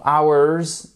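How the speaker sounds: "Hours" said as "ours", no H; silent H. Shown as a demonstration. Teacher shows learners the silent H in "Hours". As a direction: drop the H in "Hours". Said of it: "on n'entend pas le H".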